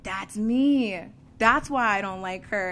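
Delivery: realization. Retold or imitated. Realizing why the new girl irritated her, exclaimed. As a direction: blurt it out as it hits.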